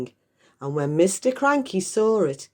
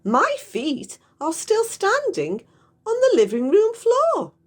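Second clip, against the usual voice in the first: put-on cackling old woman, very high and loud; high crone voice; 5 dB louder than usual. Grandma crowing to the mother, very high and loud. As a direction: crow it out like a gleeful old witch.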